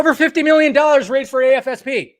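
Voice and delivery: high-pitched, worked-up outburst; too fired up. Real, not acted. Streamer ranting to viewers about an article, fired up. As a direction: get carried away announcing a big figure. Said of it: "I got a little too excited".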